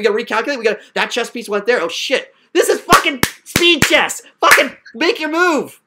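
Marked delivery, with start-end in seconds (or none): 2.5-5.8 slow, emphatic, higher-pitched demand; impatient outburst. Real, not acted. Teacher pushing a stalling student, impatient outburst. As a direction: punch each word impatiently.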